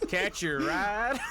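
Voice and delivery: Scooter impression. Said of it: "Scooter impression".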